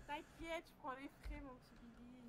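barely audible, hushed murmur into the mic; whispering. Real, not acted. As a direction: keep it to a soft whisper. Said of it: "Et arrête de chuchoter".

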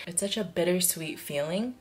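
ordinary talk

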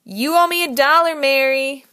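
loud, high gleeful claim; exclaiming. A narrator's reading. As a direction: crow about winning the bet. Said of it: "Cody exclaimed".

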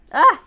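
short high loud yelp; startled yelp. Real, not acted. Player fighting enemies, loud high yelp. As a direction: cry out sharply in alarm. Startled during game play.

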